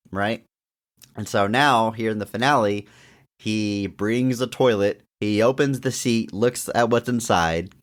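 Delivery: ordinary talk, nothing marked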